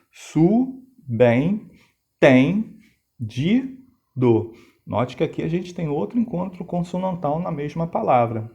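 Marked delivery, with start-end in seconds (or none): 0.1-4.5 subentendido split into syllables, each one said apart. Shown as a demonstration. Teacher spells out subentendido for the class, syllable by syllable. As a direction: chop subentendido into clear separate beats. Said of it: "a separação fica assim".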